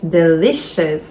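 usual speaking voice

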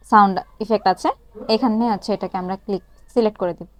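ordinary talk, nothing marked

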